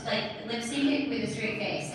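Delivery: ordinary talk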